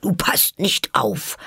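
high, slow scolding; stern. A narrator's reading. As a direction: reprimand like a strict mouse. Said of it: "sagte die Maus strenge zu Alice".

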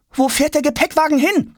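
high-pitched urgent question; anxious boy. A narrator's reading. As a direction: ask urgently in a child's high voice. Boy anxiously asks after lost suitcase. (besorgter Junge, hoch)

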